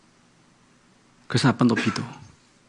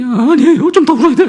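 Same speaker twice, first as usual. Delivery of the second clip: put-on much higher, louder child voice with a laugh; imitating tearful daughter; 12 semitones higher, 6 dB louder than usual. Daughter to father, high and loud tearful reply. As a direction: play the little girl still wanting to cry. Daughter answering while crying.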